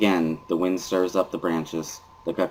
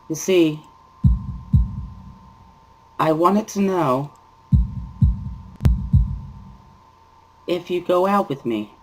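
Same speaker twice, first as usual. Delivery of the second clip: put-on high girl's voice, shy and hopeful; a nervous girl glad he came; shy schoolgirl; 11 semitones higher, 3 dB louder than usual. Shy girl explains the note to the boy.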